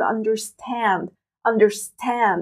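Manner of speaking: "understand" with the last syllable "stand" long and stressed. Shown as a demonstration. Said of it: "أكتر مقطع صوتي عم شدد عليه هو آخر". (الضغط على المقطع الأخير stand)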